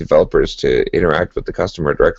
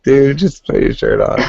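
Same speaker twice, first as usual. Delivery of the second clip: exasperated Scottish guy; 8 semitones higher than usual. Retold or imitated. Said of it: "it's like".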